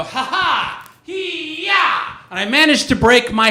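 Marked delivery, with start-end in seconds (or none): 0.0-2.2 high mock laugh, then a high kiai; hammed-up triumphant battle cry; a clumsy fighter's fake karate shout; karate yell. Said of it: "and I go".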